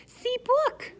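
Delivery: put-on high, loud toddler voice; eager little kid showing off his book; small boy shows his old book to the guests, high and loud; toddler Fudge. A narrator's reading. Toddler Fudge shouting proudly.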